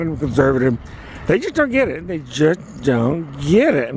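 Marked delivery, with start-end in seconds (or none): 1.3-2.1 high-pitched exasperation at usual volume; exasperated. Real, not acted. Complaining about conservative neighbors, high exasperated voice. 2.1-3.9 slow, drawn-out exasperation; slow emphasis. Real, not acted. Driving home the complaint about neighbors, slowly emphatic.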